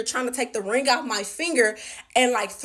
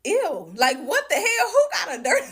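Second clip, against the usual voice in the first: laughing disgust; 7 semitones higher than usual. Retold or imitated.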